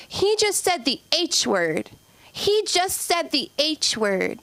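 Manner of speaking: put-on high, slow sing-song tattletale child voice; tattling kid. Kid telling the teacher on his brother, high slow tattling. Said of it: "When he's like".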